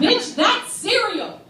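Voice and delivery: loud, slow, laughing punchline; gleeful shout. Pretend. Fan to Oprah, loud slow laughing shout. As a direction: hurl it with glee. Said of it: "didn't get to say".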